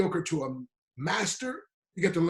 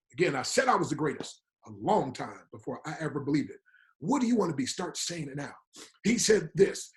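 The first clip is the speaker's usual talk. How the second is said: normal talking voice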